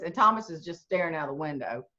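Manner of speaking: ordinary talk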